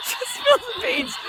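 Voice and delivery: punchline said through real laughter; laughing through it. Real, not acted. Host repeating her own joke, laughing. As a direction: giggle the words out.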